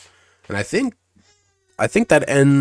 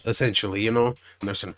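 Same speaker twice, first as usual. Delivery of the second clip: ordinary talk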